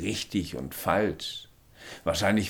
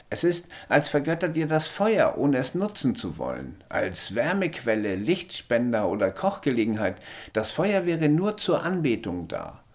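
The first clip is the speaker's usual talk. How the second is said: higher little alien voice, matter-of-fact; small alien; 7 semitones higher than usual. A narrator's reading. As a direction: speak as the tiny alien, calm and sure.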